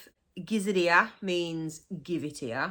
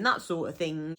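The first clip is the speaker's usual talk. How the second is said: usual speaking voice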